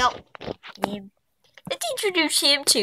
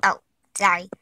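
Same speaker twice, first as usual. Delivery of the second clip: ordinary delivery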